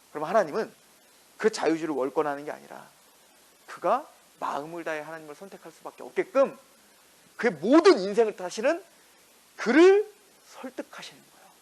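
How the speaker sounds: ordinary talk, nothing marked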